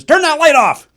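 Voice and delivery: high, loud cartoon yell; Bugs Bunny yell. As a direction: shout it like a startled cartoon rabbit. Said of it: "he jolts up from sleeping and goes".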